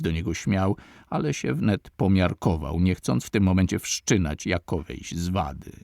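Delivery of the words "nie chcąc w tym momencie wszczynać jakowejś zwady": ordinary delivery, nothing marked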